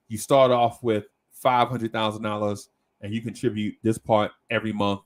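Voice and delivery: ordinary talk, nothing marked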